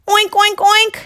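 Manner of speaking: high squeaky oinks; high piggy oinks. Acted. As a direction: oink like a happy pig. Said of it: "said Pig".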